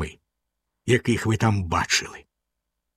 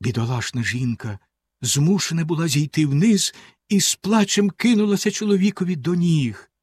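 plain reading voice

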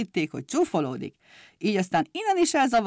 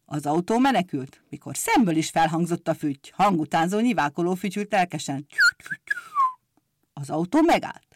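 ordinary delivery

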